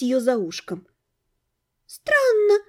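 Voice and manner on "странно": «Странно» stretched long and high; drawn out. Acted. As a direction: draw out «Странно» slowly, pitched up. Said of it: "протянула Надя".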